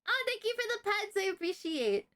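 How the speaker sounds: sudden high-pitched aside of gratitude; high-pitched thanks. Real, not acted. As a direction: pipe up high to thank a viewer. Breaks off story to thank a viewer.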